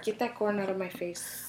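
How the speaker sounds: softly imitated movie-character voice; hushed mock-indignant quote; Nacho Libre. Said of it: "Nacho Libre".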